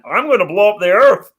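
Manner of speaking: high, loud cartoon Martian voice; Marvin the Martian. Helmeted performer walking up to Jim, mock cartoon Martian voice. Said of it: "Marvin the Martian is one of the easiest of all the Looney Tunes, uh, voices to do".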